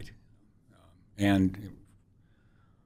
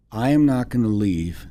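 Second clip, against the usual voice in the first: louder firm Liberian woman refusing to budge; Liberian accent; 4 semitones higher, 9 dB louder than usual. Retold or imitated. Woman refusing to leave until the wife answers, firm Liberian accent. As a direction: plant your feet, in a Liberian accent. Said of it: "in her Liberian accent".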